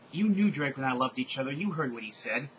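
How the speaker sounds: plain reading voice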